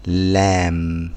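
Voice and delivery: لامب drawn out slowly, silent b; slow lamb. Shown as a demonstration. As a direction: stretch the vowel of لامب. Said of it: "في ناس بيقولوها لم". (نطق بطيء لكلمة لامب)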